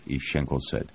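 ordinary delivery

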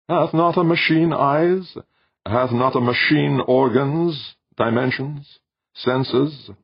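high, loud, measured declamation; machine reciting Shakespeare. A narrator's reading. Machine challenges the visitor with Shakespeare, declaiming. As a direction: declaim slowly in a high tenor.

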